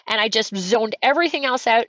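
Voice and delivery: normal talking voice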